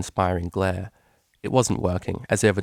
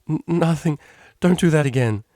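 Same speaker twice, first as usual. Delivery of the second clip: flustered squeak; 7 semitones higher, 6 dB louder than usual. Acted. Octavia squeaked, tickle threat.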